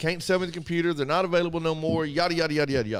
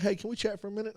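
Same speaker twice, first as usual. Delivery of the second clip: softer, easygoing request; calm and cool; 5 semitones higher, 7 dB quieter, much faster than usual. Retold or imitated. Customer approaching the manager, soft and calm. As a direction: keep it friendly, no attitude. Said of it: "I was cool".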